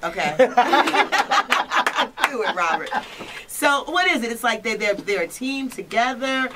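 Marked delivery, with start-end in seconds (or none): 0.4-2.2 loud laugh. Real, not acted. Laughs at the answer.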